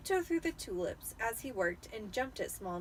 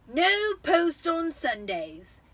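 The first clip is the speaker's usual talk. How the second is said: slow, loud, higher gloating uncle; cheerful Vernon; 7 semitones higher, 8 dB louder, much slower than usual. A narrator's reading. Vernon reminding the family, slow and gloating. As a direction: sing it out smugly. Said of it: "he reminded them cheerfully".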